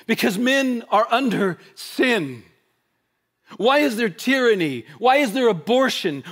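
fiery pulpit cadence building to a cry; preaching at full force. Acted. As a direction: hammer each question at the congregation. Litany of rhetorical questions.

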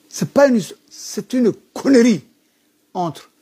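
high, slow, scornful protest; indignant outburst. Real, not acted. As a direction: spit the words out in outrage. Outburst against Djibouti government.

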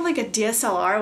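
ordinary delivery

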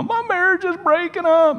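high-pitched mock wail of distress; panicked caller. Church member phoning young pastor about marriage, mock panicked. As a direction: imitate a distraught caller, voice shooting up.

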